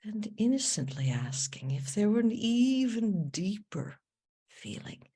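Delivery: soft meditation voice with long pauses; guided meditation. Acted. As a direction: guide them gently inward, hushed and unhurried.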